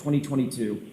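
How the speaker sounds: ordinary delivery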